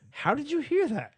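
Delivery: high-pitched incredulous question; mock bafflement. Baffled listener asks the one who understood, high incredulity.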